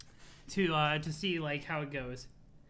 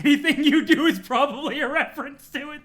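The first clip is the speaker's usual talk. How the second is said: loud laughing words; 6 semitones higher, 9 dB louder than usual. Real, not acted. Laughing at Homestuck joke.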